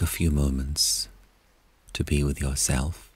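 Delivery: calm, unhurried meditation guide voice; guided meditation. Acted. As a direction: gently lead listeners inward toward peace.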